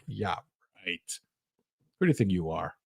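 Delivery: low, soft scornful voice; scoffing inner voice. Pretend. Inner voice putting down your dreams, low and scornful. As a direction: mutter the put-down quietly. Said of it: "And then inside, like".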